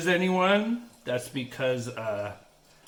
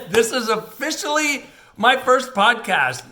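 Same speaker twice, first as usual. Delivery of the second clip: laughing; 9 semitones higher, 8 dB louder than usual. Real, not acted. Laughing at his own blunder.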